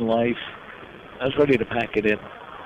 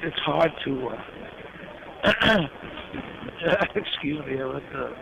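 real emotion catching in the throat, words barely coming; fighting back tears while telling the story; choked up; 2 semitones higher, 5 dB quieter than usual. Real, not acted. Recalling [name]'s kindness, choked up and halting. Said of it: "I get a bit choked up about it".

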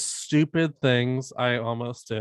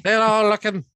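loud, high Irish lilt; big put-on Irish voice; mock Irish accent; 8 semitones higher, 6 dB louder than usual. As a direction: call it out in hammed-up Irish. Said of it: "it sounded Irish to me".

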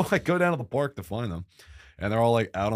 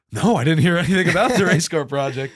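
nervous loud reply; 6 semitones higher, 6 dB louder than usual. Retold or imitated.

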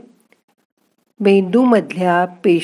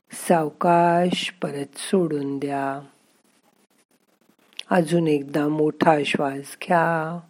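slow, soft relaxation voice; guided meditation; 4 dB quieter, slower than usual. Acted. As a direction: calmly guide the breathing, slowly. Leads breathing and relaxation exercise.

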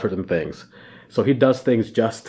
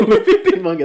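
higher, louder mock echo; teasing repeat; 9 semitones higher, 5 dB louder than usual. Co-host echoing the other's flip-flopped name, teasing. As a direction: parrot the slip back gleefully. Repeats co-host's slip to tease.

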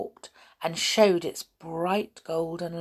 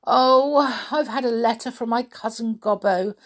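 higher, louder groaned opening; groaning unhappily; 5 semitones higher, 4 dB louder than usual. A narrator's reading. Pixie tells the children of his cousin's letter, groaning. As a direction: lament it like a troubled pixie. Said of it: "reading a letter and groaning".

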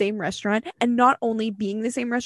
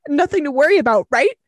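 raised, louder mock challenge; sarcastic retort; 5 semitones higher, 5 dB louder than usual. Listener confronting the guy with his own words, sarcastic. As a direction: throw his words back at him, pointedly.

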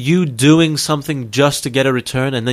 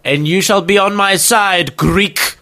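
higher, lofty English aristocrat; mock royal highness; 5 semitones higher, slower than usual. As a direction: proclaim it like pompous royalty. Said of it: "fucking highness".

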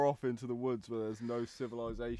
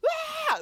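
high piercing demo scream; kid's scream; 4 dB louder than usual. Shown as a demonstration. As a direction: let out a high, shrill child's scream. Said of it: "They're not actually like".

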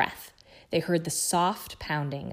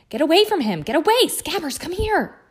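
loud, high panicked yelling; frantic shouting; 11 semitones higher, 9 dB louder than usual. A narrator's reading. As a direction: shout it high at the cat as you chase. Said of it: "his shouts at".

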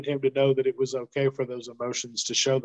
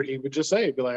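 usual speaking voice

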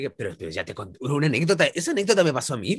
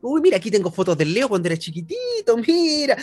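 a lightly voiced proud mother, higher; imitating his mom; 7 semitones higher than usual. Mother tells girlfriends the cat story, lightly voiced proud mom. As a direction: show off baby photos like a doting mom. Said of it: "decía".

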